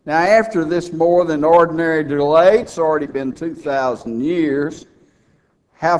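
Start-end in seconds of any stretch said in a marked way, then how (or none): none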